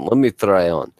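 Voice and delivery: mock Russian accent. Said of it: "I've got to do a Russian accent".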